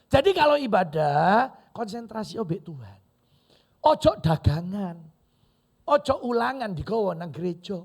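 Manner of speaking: loud, emphatic warning to the congregation; forceful preaching. Real, not acted. Preacher warning worshippers against trading in church, forceful.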